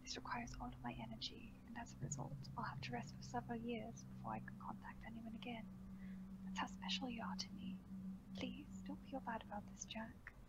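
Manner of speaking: soft, hushed, soothing voice; calm him as you confess; quiet and tender throughout. Acted. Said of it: "Voice was soothing".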